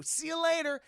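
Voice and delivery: high, loud singsong goodbye; acted impish sign-off; cheery farewell. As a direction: call it out high and playful. Puck's farewell.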